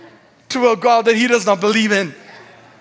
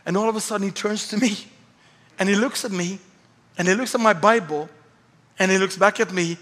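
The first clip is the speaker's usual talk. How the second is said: ordinary delivery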